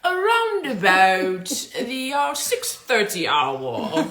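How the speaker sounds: slow, lilting put-on English accent. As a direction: play the posh English lady, drawn out. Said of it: "here goes the English accent".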